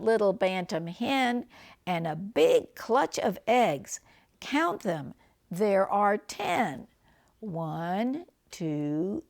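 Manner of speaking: lively picture-book reading; reading to children. Acted. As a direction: read it cosily to the grandkids.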